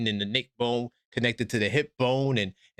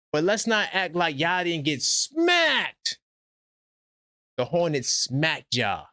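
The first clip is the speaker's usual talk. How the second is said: slow emphatic taunt; 3 semitones higher, slower than usual. Acted. Taunting Boston fans.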